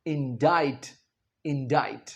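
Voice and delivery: इंडाइट said softly as in-dite; model for repetition. Shown as a demonstration. Teacher asks class to repeat: इंडाइट. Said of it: "खुद बोलें".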